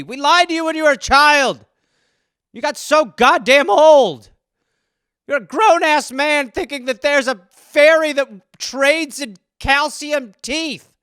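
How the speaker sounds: high, loud acted tirade; furious exasperated parent. Parent scolds grown son about Tooth Fairy, exasperated. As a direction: berate him, hammering the words.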